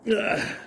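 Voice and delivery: high strained grunt uphill; effort grunt. Real, not acted. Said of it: "Sorry about my grunting and groaning".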